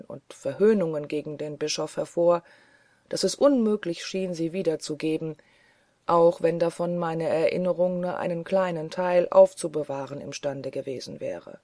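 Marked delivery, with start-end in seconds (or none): none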